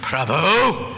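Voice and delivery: loud, drawn-out reproachful call; wrong-mood address. Pretend. Mocking how devotees misuse the word Prabhu, loud reproach. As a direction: swell into an exasperated rebuke. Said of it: "not always with the right mood".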